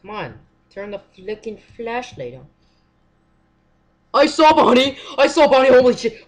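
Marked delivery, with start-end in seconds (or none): 4.1-6.2 startled yelling at full volume; real panic, shouting out loud; jolted by a jump scare, yelling; scared shout. Real, not acted.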